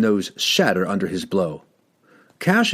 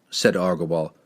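ordinary delivery, nothing marked

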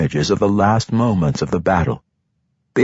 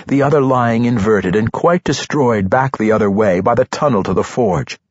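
ordinary delivery, nothing marked

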